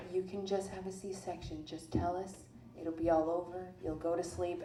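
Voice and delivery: hushed, low persuasion; soft coaxing. Retold or imitated. Nurses talking a labouring patient into surgery, soft coaxing. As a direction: coax gently, voice well down.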